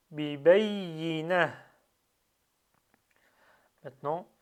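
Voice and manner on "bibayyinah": "Bibayyina" slow and loud, audible h at the end; clearly released ha. Shown as a demonstration. Teacher shows final ha with sukun, "Bibayyina" ending in released h. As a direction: stretch "Bibayyina" and breathe out the final ha. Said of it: "il faut bien le sortir".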